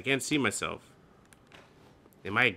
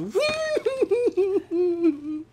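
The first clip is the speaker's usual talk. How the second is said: high giggle. Real, not acted. Laugh breaks off sentence.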